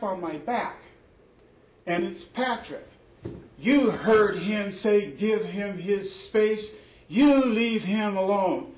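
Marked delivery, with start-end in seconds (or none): 3.6-8.7 mock angry roommate. Patrick confronts, voiced by teller.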